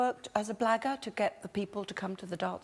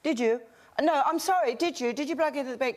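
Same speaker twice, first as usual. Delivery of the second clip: high, run-together stallholder patter; mock fairground hustle, pitched up; incomprehensible calling-out; 6 semitones higher, faster than usual. Shown as a demonstration. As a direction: demonstrate pestering passers-by with garbled, raised-pitch calls. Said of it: "I'd shout at them incomprehensibly".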